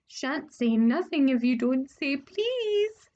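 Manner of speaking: slow teasing sing-song; annoying sing-song voice. Acted. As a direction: taunt in a lilting sing-song. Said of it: "in his annoying sing-song voice".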